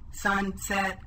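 monotone robot, syllables chopped apart; robot speak. Shown as a demonstration. Teacher shows pupils robot speak, monotone separate syllables. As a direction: chop it in two like a robot. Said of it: "say these words like the robot".

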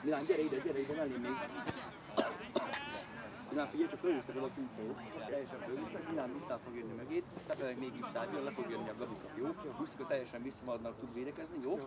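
low, soft private briefing; confidential aside. Real, not acted. Coach explains tactics to Milán beside him, confidential aside. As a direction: talk quietly to one player close by. Instructing one player close by.

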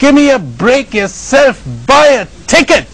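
high, loud booming retort; God's voice reply. Retold or imitated. God answering the old man, booming and high. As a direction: answer back from the heavens, mock stern. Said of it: "he heard a voice reply".

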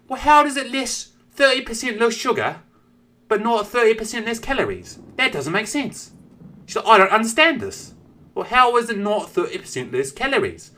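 high piping voice for a prim British mum; a mum praising the candy in a squeaky kid voice; mother in kid voice. Retold or imitated.

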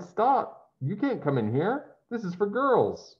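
high, sharp cry of alarm; girl crying out. Acted. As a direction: stop him at the door in a fright. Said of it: "A girl cried".

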